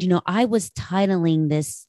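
normal talking voice

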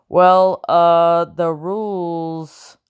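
a flustered start that trails off slowly; stumble, lose your nerve, drag out the rules; hesitant. A narrator's reading. Bellingham, caught out by Madam, hesitant and trailing off. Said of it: "from your hesitation".

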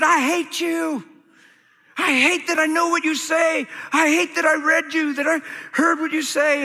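high, strained angry rant; retold screaming anger. Teenager screaming at his Bible at home, retold in high anger. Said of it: "I screamed at my Bible".

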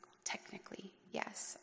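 hushed, unvoiced and reluctant; sheepish whisper. Answering her brother's pointed question in a sheepish whisper. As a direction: admit it in a guilty whisper.

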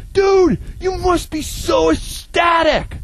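high, slow, shouted excited dude voice; excited dorm guy. Retold or imitated. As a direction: squeal it like he can't believe his luck. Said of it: "He's like".

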